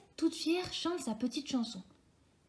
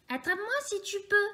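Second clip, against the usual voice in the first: quick teasing taunt; 9 semitones higher, faster than usual. A narrator's reading. Galette taunts and runs.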